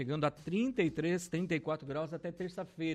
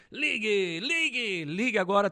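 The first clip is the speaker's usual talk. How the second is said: pushy TV-sales pitch, higher and louder; infomercial; 5 semitones higher, 6 dB louder than usual. Retold or imitated. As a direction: hype it like a shopping channel host. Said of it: "como diz o Ciro Bottini".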